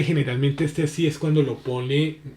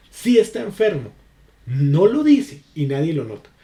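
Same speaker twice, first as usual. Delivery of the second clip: starts much higher and louder on "Si"; raised, emphatic conditional; high emphatic read; 3 semitones higher, 5 dB louder than usual. Shown as a demonstration. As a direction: push "Si" up high, then fall. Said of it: "cómo estoy utilizando diferente la acentuación".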